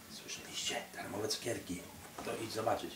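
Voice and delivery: hushed secret whisper. Pretend. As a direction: whisper it to a friend. Villains whisper about candy.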